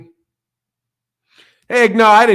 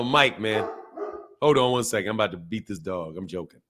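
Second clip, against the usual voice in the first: usual speaking voice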